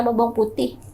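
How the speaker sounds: normal talking voice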